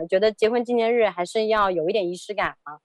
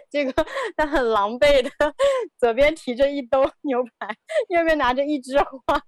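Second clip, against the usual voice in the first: amused, laughing between phrases; 4 semitones higher than usual. Real, not acted. Wife describing her husband's bedraggled arrival, laughing. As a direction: tell it through bursts of giggles.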